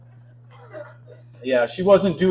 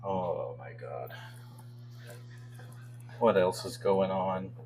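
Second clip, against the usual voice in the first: exasperated aside; 15 dB quieter, slower than usual. Real, not acted. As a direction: drop it like a tired sigh. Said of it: "comic's exasperated aside".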